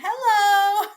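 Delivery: high, loud, cartoonish spider greeting; spider saying hello. Spider on the wall greeting the horrified traveler, high and loud. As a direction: give the spider a squeaky mocking hello. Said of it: "just sitting on a fucking wall going".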